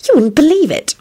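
high, gossipy emphasis; knowing. A narrator's reading. Solange sharing gossip with Quentin, high and knowing. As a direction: lift it like juicy news. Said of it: "Solange announced knowingly".

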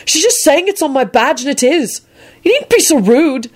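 high-pitched indignant defence; vehement. A narrator's reading. Neville scolding the rude warlock, vehement. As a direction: stand up for her, pitched high and insistent. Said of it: "Neville said vehemently".

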